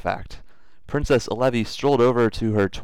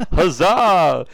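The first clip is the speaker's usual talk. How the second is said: laughing cheer; 9 semitones higher, 7 dB louder than usual. Acted. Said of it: "cried out, somewhat softly".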